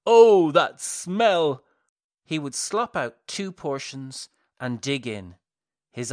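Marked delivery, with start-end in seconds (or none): 0.1-1.6 slow, loud, high ecstatic cry. A narrator's reading. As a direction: savour it like a rapturous madman. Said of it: "and cry".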